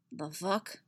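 quiet, muttered under the breath. A narrator's reading. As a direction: mumble it in confusion. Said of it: "he mutters".

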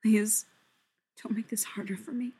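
hushed plea, sinking to near whisper; murmured. A narrator's reading. Fili begs his brother not to press him, murmuring. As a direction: plead softly, almost without voice. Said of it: "he murmurs".

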